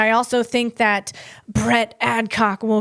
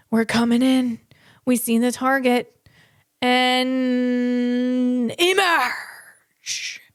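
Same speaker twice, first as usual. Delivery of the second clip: mock covert team; slower than usual. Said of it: "that's what it sounded like".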